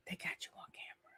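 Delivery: hushed, teasing whisper; whispered gotcha. Acted. Host whispering to the cast member that she was caught on camera.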